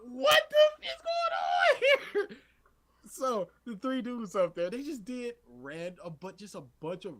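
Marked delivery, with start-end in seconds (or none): 0.1-2.4 high, loud comic disbelief; slow, exaggerated retold astonishment; bewildered. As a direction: cry out, utterly baffled.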